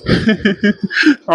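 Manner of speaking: a light amused chuckle; fiancé chuckling tenderly at teary Anu. Acted. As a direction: laugh gently at her sulking. He laughs at her tears.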